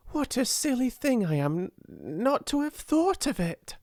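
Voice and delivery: high-pitched, excited exclamation; inspired cry. A narrator's reading. Ermengarde scolding herself at a sudden inspiration, excited cry. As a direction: cry out as the idea strikes you. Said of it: "she cried".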